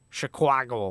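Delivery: put-on movie-cop Chicago accent, "Chicago" as "shi-KWAH-go"; over-embellished Chicago accent. Mimicking police in The Fugitive, over-embellished Chicago accent. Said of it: "you can hear the police when they talk".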